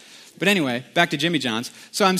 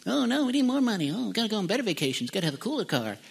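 lightly voiced whiner, higher, fading softer; mock whiny greed; 4 semitones higher, 5 dB quieter, faster than usual. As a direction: whine for more money in mockery. Mocking greedy people.

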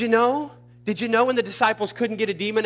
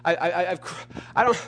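retold frustrated prayer, stumbling over words; re-enacted complaint to God; exasperated pleading; 4 semitones higher than usual. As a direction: plead higher, stammer, then rush into the questions. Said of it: "I said".

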